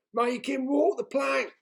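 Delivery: loud, high chanting yell; pirates yelling. A narrator's reading. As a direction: pirates baying for the plank.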